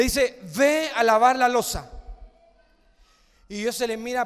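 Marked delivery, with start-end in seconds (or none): 0.6-1.9 loud order. Acted. Madre manda a la hija.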